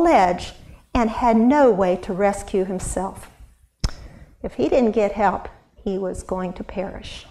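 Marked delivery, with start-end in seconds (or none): none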